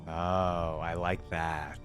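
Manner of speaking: usual speaking voice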